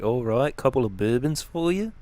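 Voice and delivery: lightly voiced Australian accent; casual Australian publican; Australian bartender. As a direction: serve drinks like an Australian barman. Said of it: "the first week was an Australian bartender".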